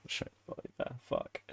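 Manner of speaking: breathy whisper through a giggle; whispered laugh. Real, not acted. Streamer repeats the request to chat in a laughing whisper. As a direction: repeat it under your breath while laughing.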